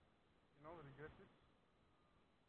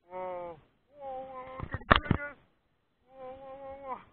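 fighting a fish; 11 semitones higher, 22 dB louder, slower than usual. Real, not acted. Fish pulling hard.

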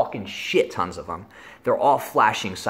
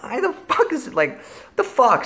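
much higher, squeaky baffled complaint; exasperated. Retold or imitated. Guy can't pee, squeaks out baffled complaint. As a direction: squeak it out in disbelief.

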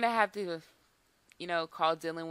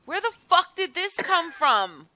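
high, loud, stunned outburst; shocked outcry; 10 semitones higher, 8 dB louder than usual. Real, not acted. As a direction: blurt it out in loud disbelief. Pet result shocks gamer.

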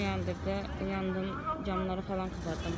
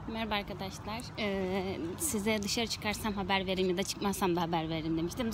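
normal talking voice

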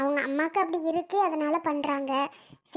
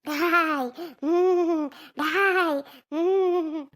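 possessed barks of daai; deity growl and hum; trance growl; much slower than usual. Acted. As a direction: bark it out like the goddess.

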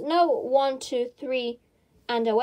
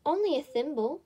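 higher, mildly sad little reply; 4 semitones higher than usual. A narrator's reading. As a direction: admit it a bit sadly. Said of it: "said Alice sadly".

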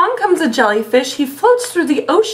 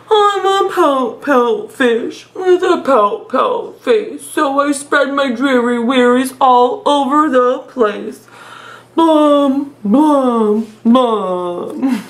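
slow, drawn-out mopey fish voice; glum pout fish voice; 2 semitones lower, slower than usual. Acted. Teacher reads the sulky fish refrain, slow and mopey. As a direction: drag each word out like a gloomy sulking fish. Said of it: "I love doing the silly voices".

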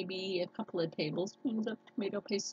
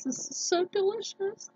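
delighted; 13 semitones higher than usual. Real, not acted. Savoring the food.

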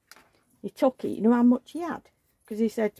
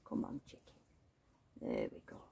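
whisper; 14 dB quieter, faster than usual. Acted. Soothing baby while turning her.

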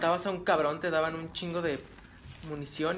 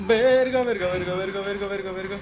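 loud panicked cursing; frantic, rising fear; 3 semitones higher, 9 dB louder than usual. Real, not acted. Panic at enemy. (maldiciendo en pánico)

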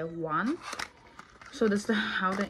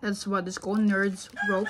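speaking around chewy candy; talking while chewing. Real, not acted. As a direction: mouth partly full of candy. Seen 1: Candy put in mouth at -5; lips and cheeks look busy while talking.

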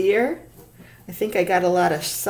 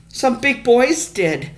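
slow, higher, louder sputter; 6 semitones higher, 7 dB louder, slower than usual. Acted. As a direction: blurt it out, flustered. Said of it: "Stuart sputtered".

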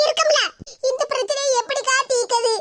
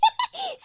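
a high, breathy villain's cackle over the plot; evil cackle; 7 semitones higher than usual. Acted. Plotter laughs at the soap-water trap, evil cackle. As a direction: cackle with glee at the scheme.

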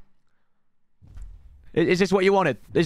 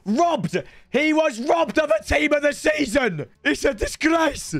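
mock outrage; 9 semitones higher than usual. Streamer protesting a player's snub, high mock outrage. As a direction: rage theatrically at the snub.

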